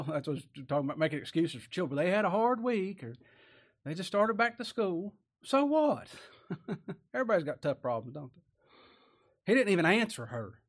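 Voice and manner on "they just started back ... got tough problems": ordinary talk, nothing marked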